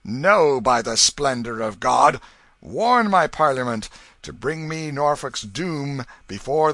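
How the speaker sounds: loud furious royal outburst; angry king. A narrator's reading. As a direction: rage at the noble, loud. King rages at the noble.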